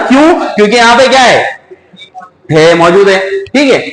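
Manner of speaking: ordinary talk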